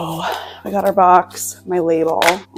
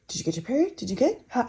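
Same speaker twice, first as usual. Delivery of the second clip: higher, eager questioning husband; husband asking about her period, higher eager voice; imitating husband Jacob; 4 semitones higher, faster than usual. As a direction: mimic Jacob pestering lightly. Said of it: "Jacob is like".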